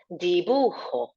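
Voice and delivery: dibujo with the j rasped at the back of the throat, di-BU-kho; throaty j. Shown as a demonstration. Teacher modelling dibujo for class, throaty j. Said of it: "Nice he sound at the back of our throat".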